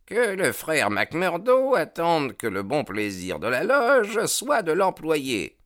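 high, very slow aged voice; old secretary. A narrator's reading. The old secretary Haraway speaks up to the lodge, slow and high. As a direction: drag it out like an old greybeard.

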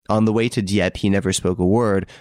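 ordinary delivery, nothing marked

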